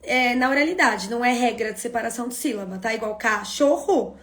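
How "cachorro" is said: cachorro slowly in pieces, ca-cho-rro; slow syllable-by-syllable "cachorro"; split into syllables. Shown as a demonstration. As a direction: break "cachorro" into three spoken beats. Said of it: "Na oralidade eu separo assim".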